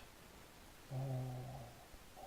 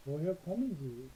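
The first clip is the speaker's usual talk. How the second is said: slow model; much slower than usual. Shown as a demonstration. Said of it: "방금 여기 읽었죠".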